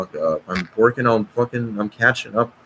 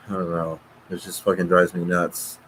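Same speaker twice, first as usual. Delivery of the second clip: normal talking voice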